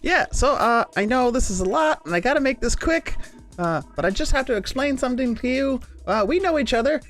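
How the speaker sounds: high character voice. Acted. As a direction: lift the pitch way up in character. Player voices Kalo in character.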